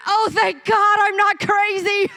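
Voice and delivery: put-on high, loud relieved kid voice; a boy overjoyed he is believed; relieved child.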